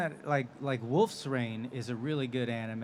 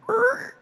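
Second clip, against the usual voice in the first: goblin screech, much higher and louder; goblin growl; 8 dB louder than usual. Retold or imitated. Recording Goblin number thirteen for the director, goblin screech. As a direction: squeal it out like a pet guinea pig turned goblin. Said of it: "reached back there".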